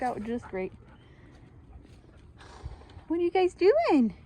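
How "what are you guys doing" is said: talking to dogs. Real, not acted.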